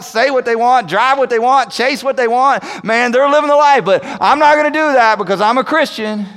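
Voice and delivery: mock envious churchgoer, loud and high; jealous churchgoer. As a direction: voice a believer who secretly envies sinners. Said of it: "We still struggle sometimes with".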